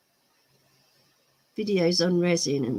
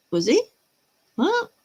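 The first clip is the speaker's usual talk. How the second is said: surprised what; 8 semitones higher, 4 dB louder than usual. Real, not acted. Surprised by a chat comment.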